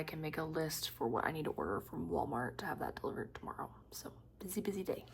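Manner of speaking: soft, tired whisper. Real, not acted. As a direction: whisper it wearily. Tired, hushed.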